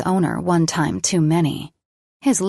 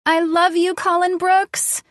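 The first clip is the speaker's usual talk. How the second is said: screamed; 12 semitones higher, 3 dB louder than usual. A narrator's reading. Girlfriend screaming love at him on video. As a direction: shriek it out in delight. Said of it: "she screamed".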